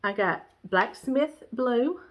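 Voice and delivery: ordinary delivery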